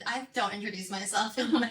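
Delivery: normal talking voice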